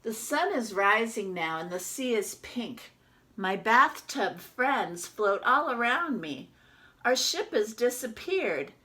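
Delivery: lively storybook reading as the duck; reading to children. Acted. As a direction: read it out to the class like a picture book. Teacher reads picture book aloud.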